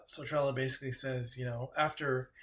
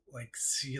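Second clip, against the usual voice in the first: ordinary delivery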